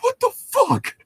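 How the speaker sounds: high, incredulous; mock outrage. Retelling his reaction to the story, mock shocked. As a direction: act out the reader's shock. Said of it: "It's like".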